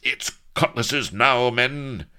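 loud, high battle shout; roaring. A narrator's reading. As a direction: roar the order to the men.

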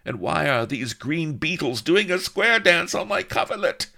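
high, loud, woozy bewilderment; see the beetles dancing; hallucinating princess. A narrator's reading. Drugged princess asking her maid, high loud bewilderment.

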